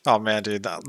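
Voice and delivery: ordinary talk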